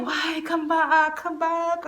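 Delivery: soft mock pleading; softly whined imitation of begging. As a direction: coax someone back, imitating a wheedling boy. Imitates him begging her back.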